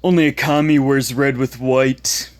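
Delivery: taunting schoolyard bully voice; mocking bully. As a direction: jeer like an online troll. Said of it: "the ones that I, I quoted".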